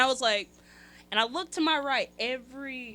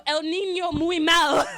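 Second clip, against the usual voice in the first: loud, slow, high broken Spanish; 6 semitones higher, 14 dB louder than usual. Retold or imitated. Passenger telling the Spanish-speaking mom her kid is bad, loud and slow. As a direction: spell it out to a stranger. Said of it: "and I go".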